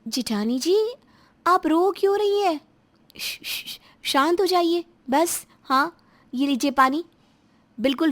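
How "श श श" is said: soft, voiceless shushing; soothing shush. Acted. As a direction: hush someone who is crying. Shushes crying sister-in-law. (शांत कराते हुए श-श की आवाज़)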